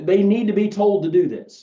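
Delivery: firm and forceful, a little upset. Real, not acted. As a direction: insist hard on this point. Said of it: "aggravated, upset voice".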